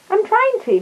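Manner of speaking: high squeaky effort; straining to imagine. Acted. Paddington answering Mr Gruber, straining high voice. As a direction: reach up high as if trying hard.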